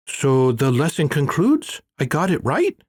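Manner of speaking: a keen pupil checking he passed; Manjunath, hopeful. A narrator's reading. Manjunath asks eagerly.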